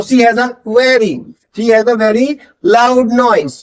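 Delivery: very slow model sentence, V in very set out; V-focused sentence drill. Shown as a demonstration. Teacher for learners to repeat, slow with V focus on very. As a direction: spell out she has a very, word by word. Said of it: "वी sound पे focus करना है".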